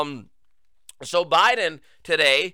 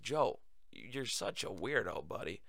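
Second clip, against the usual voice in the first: hushed mock aside; 9 semitones lower, 14 dB quieter than usual. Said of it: "I'm like".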